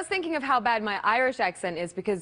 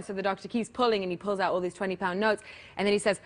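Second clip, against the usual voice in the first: ordinary delivery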